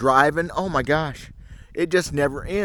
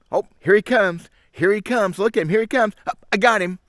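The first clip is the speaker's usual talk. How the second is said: higher, lively imitation of a fisherman spotting a bite; mock excited angler; 6 semitones higher, 3 dB louder than usual. Vlogger mimicking the video's anglers with mild mock excitement. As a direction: lightly ham up the guys on sonar.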